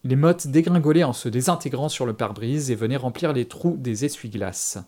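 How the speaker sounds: ordinary delivery, nothing marked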